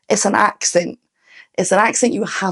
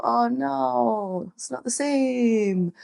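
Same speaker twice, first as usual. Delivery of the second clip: mock disappointed; 6 dB quieter, much slower than usual. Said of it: "listen to it and go".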